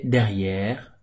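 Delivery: derrière with a long open è; derrière, stretched è; slow drawn-out derrière. Shown as a demonstration. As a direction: take your time on derrière. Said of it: "on prend notre temps pour la prononciation".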